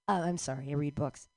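normal talking voice

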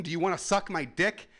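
ordinary talk, nothing marked

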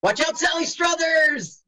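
high, excited call-out; mock warning shout. Real, not acted. Calling out warning at screen.

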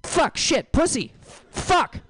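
put-on high, strained kid voice swearing; a little boy whose tongue trips into curses; squeaky child blurting swear words; kid trying to speak. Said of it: "And I'd be like".

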